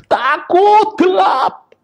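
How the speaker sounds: high, slow, stretched cry of a terrified dying man; jeritan takut. Retold or imitated. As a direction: wail it like someone scared of the dark. Dying man screaming in fear. (jeritan ketakutan orang sekarat)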